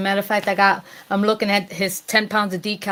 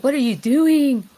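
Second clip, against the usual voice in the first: a touch louder and higher, slow alarmed question; mock alarmed call; 5 semitones higher, 8 dB louder than usual. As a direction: call out as the worried cousin, lightly. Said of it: "she was like".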